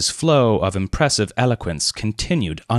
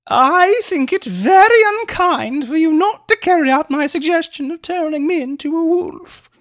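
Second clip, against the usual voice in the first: much higher ladylike complaint; teasing lady; 5 dB louder than usual. A narrator's reading. Mary chiding Leonard about the wolf, high mock-hurt. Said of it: "said Mary Hampton".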